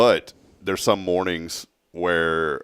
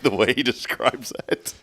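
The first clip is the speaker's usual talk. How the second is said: ordinary talk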